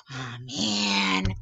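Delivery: hammed-up letdown; mock disappointment. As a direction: let the air out like you lost. Girl disappointed by a yes.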